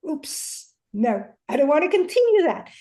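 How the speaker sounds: a quick, loud, alarmed catch-up; startled self-correction. Retold or imitated. Mother catching herself repeating her own mother, startled. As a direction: catch yourself mid-habit and snap out of it. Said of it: "And I was like".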